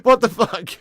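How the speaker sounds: high, loud cry through laughter; laughing outburst. Real, not acted. Streamer cracking up at a viewer joke, high laughing outburst. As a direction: blurt it out mid-laugh.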